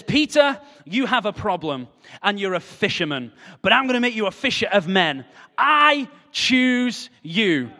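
booming preacher call; emphatic divine call. Acted. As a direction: call Peter out with conviction. Preacher voices God calling Peter.

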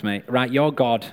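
ordinary talk, nothing marked

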